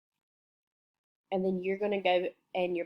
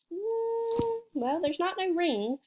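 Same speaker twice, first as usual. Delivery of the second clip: much higher, playful hum; mock doubt; 10 semitones higher, 3 dB quieter, slower than usual. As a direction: pretend to be puzzled. Teacher rejects wrong answer.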